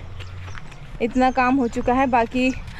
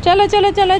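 high, louder chant of encouragement; urging on; 8 semitones higher, 6 dB louder than usual. Real, not acted. Mother cheering toddler on to walk, high urging chant.

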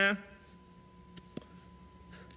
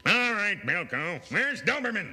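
barking colonel; 6 semitones higher, 5 dB louder than usual. Acted. Sketch, colonel addressing Bilko.

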